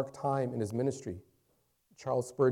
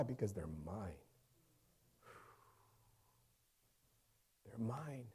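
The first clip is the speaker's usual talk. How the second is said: low, very soft declaration; tender claim; 3 semitones lower, 14 dB quieter than usual. Acted. Parent explaining love for the child, tender and quiet. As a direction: drop to a hushed, loving certainty.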